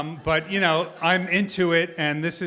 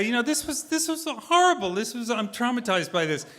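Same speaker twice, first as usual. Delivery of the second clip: mock anguish; 8 semitones higher than usual. Complains to daughter after humiliation.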